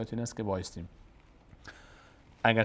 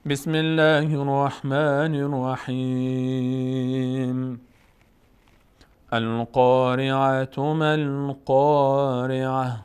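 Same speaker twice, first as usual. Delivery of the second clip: slow, louder, melodic tajwid recitation; Quran recitation; 3 dB louder, much slower than usual. Shown as a demonstration. Teacher reciting the surah for students, slow chant. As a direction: chant each verse slowly with long held vowels.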